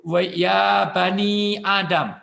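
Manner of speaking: slow chanted Arabic quote; slow reverent Arabic chant; Quranic recitation. Acted. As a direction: intone it like scripture, slowly.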